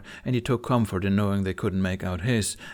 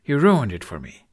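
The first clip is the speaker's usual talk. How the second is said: a louder, sulky complaint; griping; 6 dB louder than usual. A narrator's reading. Hiccup complains to Toothless, louder sulky gripe. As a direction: grumble it at your friend. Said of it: "Hiccup griped".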